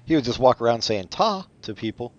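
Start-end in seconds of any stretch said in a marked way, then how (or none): 1.1-1.5 high, chirpy "ta"; a bright little "ta" said like a greeting; mock British ta. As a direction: toss off a perky "ta". Mimicking TA as British ta.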